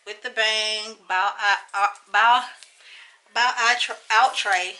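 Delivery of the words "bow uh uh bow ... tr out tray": stumbling over the word; tongue-tied. Real, not acted. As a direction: trip over "bow out tray" loudly. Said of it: "Lord Jesus, can I talk?".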